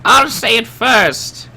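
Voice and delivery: high, loud, eager voice; excited high voice. Acted. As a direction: stop them, eagerly and loud. Excited NPC through stone.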